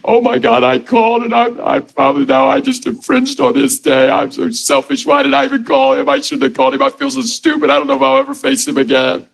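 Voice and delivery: a high-pitched, overwrought imitation of a caller; mock distraught caller. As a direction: spiral into panic like a rejected caller. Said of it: "they're like".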